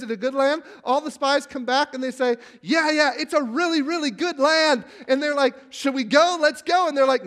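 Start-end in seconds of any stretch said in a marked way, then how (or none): 2.6-4.8 spies' excited report. Retold or imitated. 5.7-6.9 eager people. Retold or imitated.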